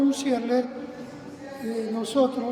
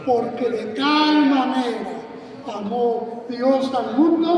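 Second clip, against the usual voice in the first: recited verse. Pretend. Class reciting verse from memory.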